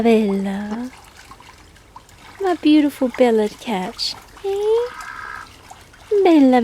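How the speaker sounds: high cooing pet voice; high sing-song voice soothing Bella the cat. Real, not acted. Baby talk to cat Bella.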